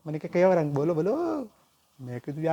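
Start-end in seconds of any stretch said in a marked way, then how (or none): 0.3-1.5 lightly voiced boy, raised and playful; a hint of a teasing boy. Boy teasing the uncle, a touch higher. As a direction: tease the uncle like a cheeky kid.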